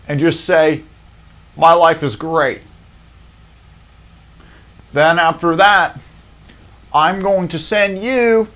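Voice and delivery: hammed-up host, rising to a big finish; Steve Harvey impression. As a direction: preach the fix-it plan like a TV host. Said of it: "Steve Harvey is like".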